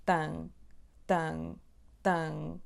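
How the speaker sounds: tan with a nasal a, repeated; nasal a drill. Shown as a demonstration. Teacher drilling the nasal a in tan. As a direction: say tan, tan, tan with the a through the nose. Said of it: "temos o som A nasal".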